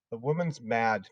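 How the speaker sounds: muttering it privately; to herself. Acted. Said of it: "Miss Honey was telling herself".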